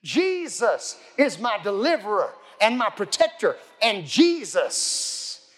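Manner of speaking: slow, measured proclamation with stretched words; preacher's declaration. Acted. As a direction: declare each phrase like a creed, pausing between words. Demonstrates confessing faith aloud.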